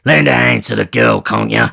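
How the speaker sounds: loud impatient bark; impatiently. Acted. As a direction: snap the order. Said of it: "replied Sikes impatiently".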